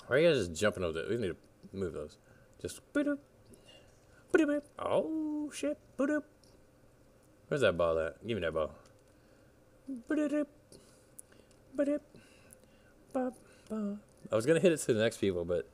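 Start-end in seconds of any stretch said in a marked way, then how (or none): 2.6-6.2 squeaky cartoon boops. Voicing cartoon boops while hitting ball. 9.9-14.0 squeaky cartoon boops. Voicing cartoon boops while hitting ball.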